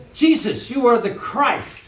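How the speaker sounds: high, eager declaration; Peter's eager realization; excited Peter. Retold or imitated. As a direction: blurt it out, high and thrilled. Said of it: "Peter says".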